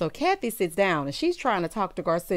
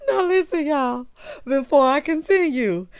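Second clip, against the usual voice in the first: high voice, fighting more laughter; real laugh-strained, raised pitch; recovering from laughing; 5 semitones higher than usual. Real, not acted. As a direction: try to carry on after hollering with laughter. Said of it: "I can continue without hollering".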